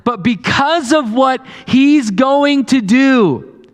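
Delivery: preacher rousing the congregation; preaching at full force. Acted. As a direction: build toward hope.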